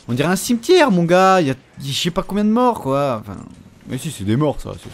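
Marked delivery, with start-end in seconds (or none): 0.1-1.4 excited. Real, not acted. Stupéfait devant les morts.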